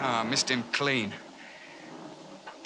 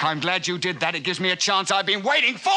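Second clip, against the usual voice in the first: angry shout; 7 semitones higher than usual. Acted. Threatening fight with the hunter.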